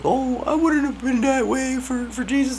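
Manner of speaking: high, prissy mock voice; mocking boastful people. Mocking people who claim loyalty, in a high mock voice. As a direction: sneer it in a squeaky know-it-all voice. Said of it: "who be like".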